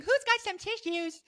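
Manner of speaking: high, slow, sing-song mock guard; mock a guard hunting for tissues, high and slow; mock security guard. Host mocking security guards at concerts, high slow mock voice. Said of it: "They're not walking around going".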